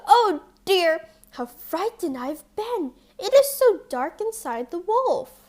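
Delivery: put-on high, loud frightened girl bursting out; relieved cry. A narrator's reading. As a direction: shaken child freed from the wolf. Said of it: "she jumped out and cried".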